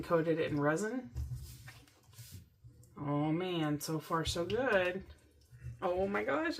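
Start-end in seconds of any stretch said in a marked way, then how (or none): none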